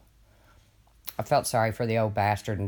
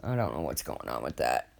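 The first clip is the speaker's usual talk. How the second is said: much lower, softer, put-on deep voice; mock deep voice; 12 semitones lower, 5 dB quieter than usual. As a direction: repeat it in a low, mock-ominous voice. Repeats line in put-on voice.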